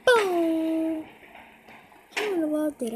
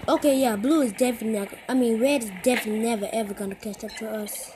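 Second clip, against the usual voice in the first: fast excited; 5 semitones lower, faster than usual. Real, not acted. Rushed excited talk.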